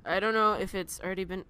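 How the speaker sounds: ordinary delivery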